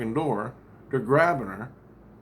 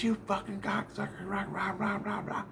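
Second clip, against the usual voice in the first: imitating a woman yelling insults, pitched up high; retold scream; 7 semitones higher, 8 dB quieter than usual. Dream woman yelling at police, mock high-pitched scream. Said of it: "She's screaming".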